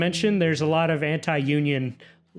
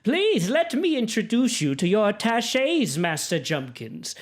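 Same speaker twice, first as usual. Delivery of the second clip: high-pitched lady's voice, settling at the end; noblewoman making introductions; 7 semitones higher than usual. Acted. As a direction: introduce guests formally in a high, ladylike voice. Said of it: "she says".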